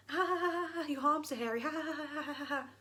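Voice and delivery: high fake laugh with a dig slipped in; snide mock laughing. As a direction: pretend to laugh off an insult. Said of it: "pull it in snidely".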